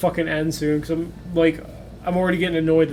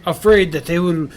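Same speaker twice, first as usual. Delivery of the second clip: father character; 4 dB louder than usual. Acted.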